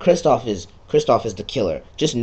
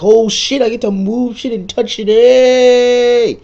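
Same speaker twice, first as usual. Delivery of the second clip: high, loud, thrilled squeal; excited; 14 semitones higher, 11 dB louder, slower than usual. Pretend. Player imagining being surprised by a new game feature, in a loud, high, thrilled shout. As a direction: yell it like a kid who just got a new toy. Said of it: "I'd be like".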